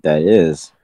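usual speaking voice

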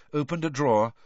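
usual speaking voice

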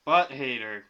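loud call to co-host. Real, not acted. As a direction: call his name loud. Calling out to co-host.

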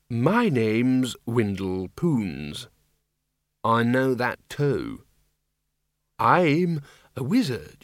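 put-on dazed, drawn-out old zombie; slow zombie wizard. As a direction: speak slowly, a little bewildered.

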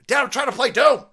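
high, loud whiny yell at dad; retold kid yell. Kid yelling at dad over the phone line, loud whiny shout. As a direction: shout it like a kid interrupted mid-game.

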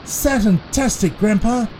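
high, excited exclamation; delighted boy. A narrator's reading. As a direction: cheer as a thrilled child. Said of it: "said Curtis".

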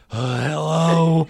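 breathless hello. Retold or imitated. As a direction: puff out a tired hello like a man after a climb. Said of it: "the way he answers is like".

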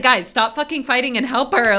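exasperated scolding. Pretend. Viewer yelling at TV doctors to help the patient, exasperated. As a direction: scold them like squabbling colleagues.

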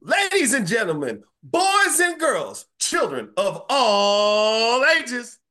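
high, loud, stretched showman announcement; ringmaster. Acted. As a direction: boom it out like a circus ringmaster. Hosting intro, announcer style.

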